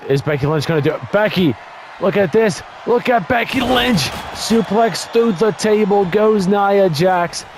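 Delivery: sportscaster losing it over the table spot; excited play-by-play. Real, not acted. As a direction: call the big suplex at full hype. Big suplex moment in commentary.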